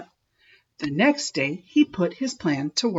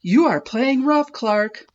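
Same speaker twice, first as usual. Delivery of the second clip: a touch higher and louder; crying out; 6 semitones higher, 4 dB louder than usual. A narrator's reading. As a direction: complain to the rough shark. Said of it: "cried the other kids".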